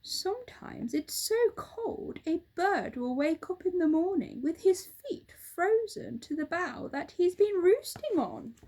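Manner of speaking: lightly voiced bird telling a tale; roly-poly bird. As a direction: spin a chilling tale to the monkey.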